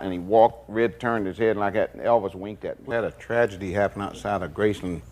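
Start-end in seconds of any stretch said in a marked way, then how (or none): none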